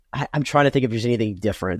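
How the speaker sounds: normal talking voice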